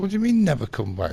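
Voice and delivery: high, fast, loud retold protest; indignant disbelief. Customer told never to come back, indignant. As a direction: act out outrage at being banned. Acted protest at being banned.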